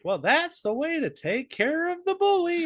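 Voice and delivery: very high cartoon girl voice; chirpy kids' show host; Dora voice. Dora wrapping up the ad, in a high cartoon girl voice. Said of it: "Dora's like".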